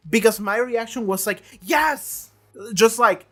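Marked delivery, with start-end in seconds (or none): 1.6-2.3 high re-enacted cry of vindication; frustrated approval. Viewer reacts to Katrina's call-out, acted frustrated relief. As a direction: act out a fed-up, emphatic agreement. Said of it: "you can tell that I was so frustrated".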